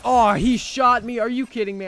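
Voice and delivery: furious high yell; screaming in outrage at the game; raging gamer. Real, not acted. Shot in game, yelling.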